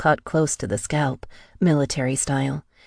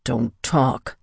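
growl; 3 semitones lower than usual. A narrator's reading. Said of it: "he growled".

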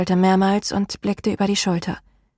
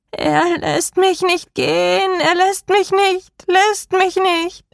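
high, slow, warped wail; distorting voice; 11 semitones higher, 3 dB louder, slower than usual. A narrator's reading. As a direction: let the voice twist further on each repeat. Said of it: "Mit jedem Wort klang ihre Stimme verzerrter".

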